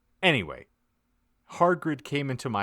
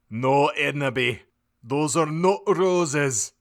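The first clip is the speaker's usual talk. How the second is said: Hagrid's voice; 7 semitones higher, 7 dB louder, slower than usual. A narrator's reading. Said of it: "Hagrid says".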